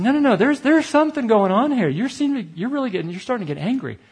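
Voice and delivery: high, urgent objection easing down at the end; imitated insistence. Colleague telling him something is going on, high-pitched insistence. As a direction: mimic a colleague cutting in to insist.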